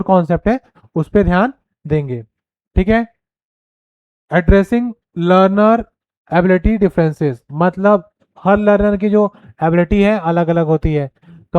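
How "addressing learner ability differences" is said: very slow, each word spaced out; dictating a point; slow dictation. Shown as a demonstration. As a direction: read it slowly so students can write. Reading slide point aloud.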